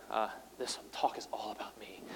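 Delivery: jokey stage whisper; whispered aside. Real, not acted. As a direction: whisper it as a joke. Joking aside to the audience.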